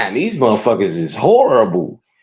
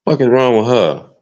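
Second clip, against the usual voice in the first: ordinary talk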